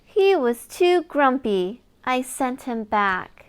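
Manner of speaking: ordinary delivery, nothing marked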